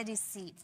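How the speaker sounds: रिसीव softly, with long ee in the second syllable. Shown as a demonstration. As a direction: say रिसीव as "ri-seev". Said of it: "ई में ही निकलेगी".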